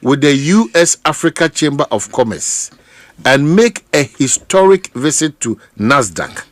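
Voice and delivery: slow, deliberate reading of a statement; measured official read-out; reading aloud. Shown as a demonstration. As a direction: read the release out word by word. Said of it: "reads the news release aloud".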